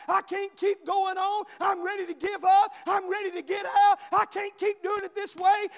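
building to a loud, high pulpit shout; impassioned revival preacher at full volume; full-force preaching. Acted. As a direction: preach the desperate plea, rising line by line. Preaches the cry and God's answer.

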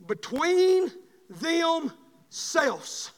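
slow, weighty reading; scripture reading. Shown as a demonstration. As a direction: read the verse slowly, word by word. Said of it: "Look with me in verse twenty-four".

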